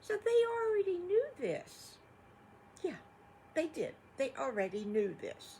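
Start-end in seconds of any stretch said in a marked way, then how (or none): 0.0-3.9 much higher and louder, eager; storyteller's big emphatic aside. Acted. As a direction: make the kids feel the surprise. Storyteller stresses the mamas knew.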